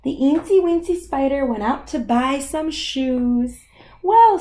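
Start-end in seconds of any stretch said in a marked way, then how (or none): 4.0-4.4 high, loud drawn out well; mama voice. Acted. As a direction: sound like a fussing mum. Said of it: "said her mama".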